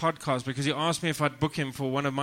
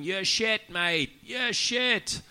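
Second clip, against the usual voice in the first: heckler; 7 semitones higher, much slower than usual. Retold or imitated. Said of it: "The crowd started going".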